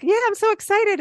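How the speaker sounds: high bright burst on so excited; mock excited. Host replaying her earlier gush, mock excited. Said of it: "when I was like".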